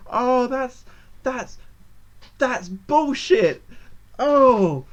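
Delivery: high, halting, drawn-out complaint; stall, then burst out loud; exasperated protest. Real, not acted. Player protesting an unfair loss, exasperated outburst.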